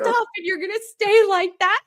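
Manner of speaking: high, scolding mom voice; mom's voice. Retold or imitated. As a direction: scold like a mother in your head. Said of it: "I just hear my mom's voice in my head".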